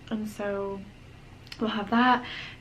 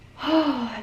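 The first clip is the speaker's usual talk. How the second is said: loud, higher-pitched sigh; weary sigh; 5 semitones higher, 6 dB louder than usual. Real, not acted. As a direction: let out a big loud sigh. Sighing over very tender breasts.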